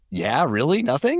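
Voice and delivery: rising disbelief on nothing; mock incredulous question, pitch shooting up. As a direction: act like you can't believe it. Incredulous retold reply.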